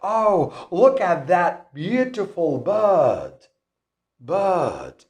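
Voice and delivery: British "bird" with no R, drawn out; slow British line, silent R in "bird"; British accent. Shown as a demonstration. Teacher showing learners the silent middle R, British accent. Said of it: "No R in the middle of the word".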